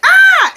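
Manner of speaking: sudden very high, loud yelp of pain; hammed-up cry of pain; retold scream. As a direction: scream as if struck in the stomach. Melissa hit in the stomach.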